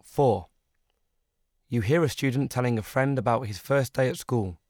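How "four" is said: ordinary delivery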